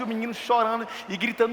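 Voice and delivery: ordinary talk, nothing marked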